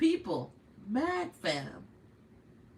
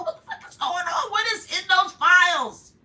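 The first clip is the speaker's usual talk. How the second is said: real disbelief; 9 semitones higher, 9 dB louder than usual. Real, not acted. Shocked reaction to the news.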